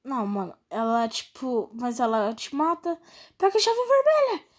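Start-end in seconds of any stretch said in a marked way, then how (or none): 3.4-4.4 high urgent yell to a teammate. Real, not acted. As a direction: call it out fast and urgent. Urging teammate mid-chase.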